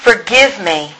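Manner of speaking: ordinary talk, nothing marked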